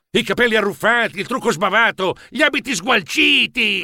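excited, high-energy appraisal; enthusiastic. Acted. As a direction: rave over each detail in turn. Director enthuses over star's messy look.

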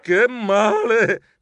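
high, drawn-out yelp; cry of pain. A narrator's reading. Geronimo hurt falling off the skate, pained wail. Narrator falls and cries in pain.

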